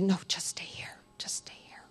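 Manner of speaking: soft, secret whisper; whispered advice. Retold or imitated. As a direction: hush it so the boss won't hear. Nurse whispering she can stay.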